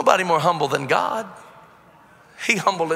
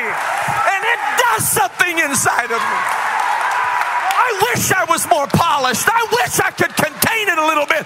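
full-throated, high preacher's shout; going off the rails; 11 semitones higher than usual. Real, not acted. Preacher who believes what he's saying, shouting fervently. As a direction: yell it with total conviction. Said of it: "That's where I really go off the rails".

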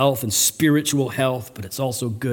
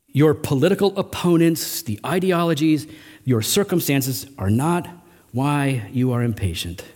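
usual speaking voice

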